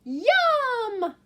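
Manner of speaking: high, loud yum; yelled yum. Shown as a demonstration. Teacher leading the class in a loud yum. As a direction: yell it with the kids. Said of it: "Can you yell yum with me?".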